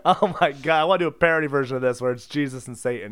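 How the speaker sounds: normal talking voice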